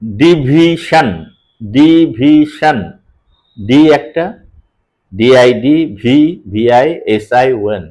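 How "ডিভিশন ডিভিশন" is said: "ডিভিশন" very slowly as di, vi, shan; syllable split. Shown as a demonstration. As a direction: equal gaps between the syllables of "ডিভিশন", said twice. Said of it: "উচ্চারণ যখন বলছি".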